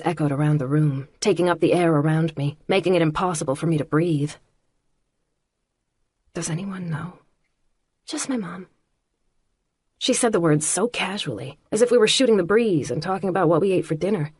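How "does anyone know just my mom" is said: hushed, unvoiced question and answer; whisper. A narrator's reading. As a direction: keep it in a bare whisper. Whispered night conversation.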